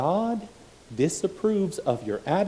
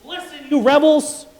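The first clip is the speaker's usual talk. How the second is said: much higher, louder rebuke; furious shout; 11 dB louder than usual. Retold or imitated. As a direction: scream it even harder at the rebels. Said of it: "Moses got up there and says".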